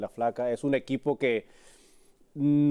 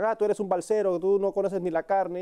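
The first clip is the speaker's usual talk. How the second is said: taunting schoolyard jab, higher; mocking schoolkids; 7 semitones higher than usual. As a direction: mimic the kids teasing him, pitched up. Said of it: "cada vez que me decían".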